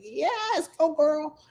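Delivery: high, thrilled cheer. Retold or imitated. Mom cheering a sleep trainer's success, high thrilled cheer. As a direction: cheer her on like a fan. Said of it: "I'm like".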